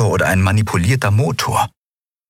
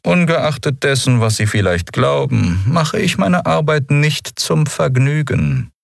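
higher-pitched, measured official voice; cold, composed commodore; 8 semitones higher than usual. Acted. Commodore correcting a prisoner about his work, cold and composed. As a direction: answer with controlled formality.

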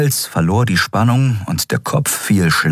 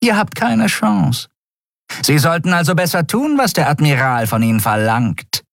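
higher, mocking warning from the Skria; grinning threat; 8 semitones higher than usual. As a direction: threaten with a grin. Skria Shiar speaks, grinning. (höhere, spöttische Drohung der Skria)

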